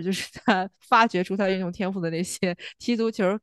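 ordinary delivery

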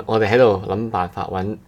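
ordinary talk, nothing marked

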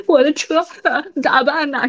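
retelling with a put-on sob in the voice; mock tearful. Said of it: "带着哭腔". (模仿带哭腔)

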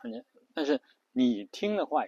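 usual speaking voice

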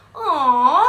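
夸张高亢地一声"哦"; 像说笑话一样拉高嗓门起个头; 搞笑台词. Retold or imitated. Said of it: "我接下来那句白是". (夸张地大声起调)

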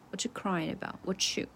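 ordinary talk, nothing marked

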